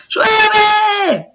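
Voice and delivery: high, drawn-out full shout. Shown as a demonstration. Teacher showing students maximum volume, shouting. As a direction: yell it out long and high. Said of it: "el volumen con que lo dije".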